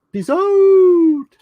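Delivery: very high, loud, long-held OUT; exaggerated sign-off. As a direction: hold "out" on a high loud note.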